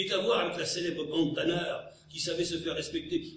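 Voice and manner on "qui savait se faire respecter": ordinary delivery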